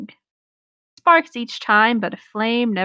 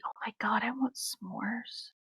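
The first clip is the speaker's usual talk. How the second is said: whisper; 17 dB quieter than usual. Real, not acted. Player confesses to friends she wants s'mores, whispered. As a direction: breathe it out like a secret craving.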